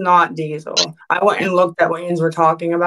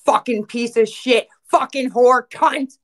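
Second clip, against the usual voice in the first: building furious cursing, rising high; furious ranting; 4 semitones higher than usual. Real, not acted. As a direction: spit the insults out in rage.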